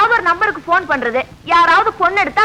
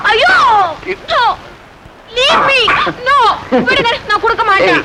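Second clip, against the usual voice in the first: protesting; 6 semitones higher than usual. Acted. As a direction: cry out while fending someone off. Playful struggle over a cigarette. (உரக்க மறுத்துக் கத்துதல்)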